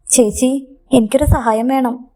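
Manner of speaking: usual speaking voice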